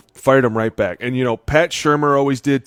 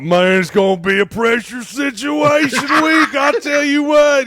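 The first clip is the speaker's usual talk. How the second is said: imitating Freddie Kitchens; 4 dB louder, slower than usual. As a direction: ham up the folksy coach. Imitating Freddie Kitchens in O-line room.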